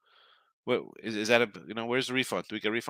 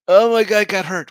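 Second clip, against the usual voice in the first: high, loud nasal-free mock whine, much higher than his own voice; imitating a whining gambler, high and loud; mock whiny bettor; 9 semitones higher, 13 dB louder than usual. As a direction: sneer it out like a crybaby bettor.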